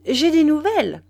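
bursting out high and loud with news; eager news. Acted. Wife announcing news to Duroy, eager and loud.

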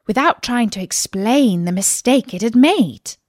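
ordinary delivery, nothing marked

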